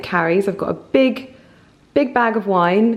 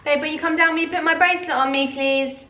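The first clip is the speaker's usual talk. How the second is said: higher, quicker call for a favour; calling out to partner; 8 semitones higher than usual. Real, not acted. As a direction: call babe over, sweetly raised pitch. Calls partner for help.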